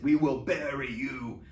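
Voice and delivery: lightly voiced Soviet leader, drawn out; a hint of a threatening Khrushchev; menacing Khrushchev quote. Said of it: "tells the United States".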